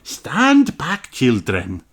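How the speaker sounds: high, slow, kindly teacher voice; a teacher explaining to her class slowly; Madame Gazelle voice. Acted. Teacher telling children about the time capsule, high and slow.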